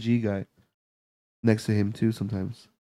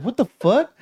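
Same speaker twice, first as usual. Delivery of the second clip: startled what the fuck; 11 semitones higher, slower than usual. Retold or imitated. Startled, retold.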